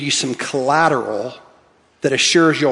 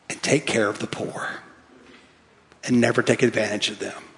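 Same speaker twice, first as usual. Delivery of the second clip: soft solemn aside; 6 semitones lower, 9 dB quieter than usual. Acted. Preacher's quiet close of the list.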